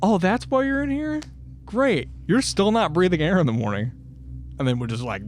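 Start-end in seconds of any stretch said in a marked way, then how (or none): 0.0-3.9 much higher, sarcastic sing-song; mock delighted inmate. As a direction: fake cheerful then threatening. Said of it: "they'd be like".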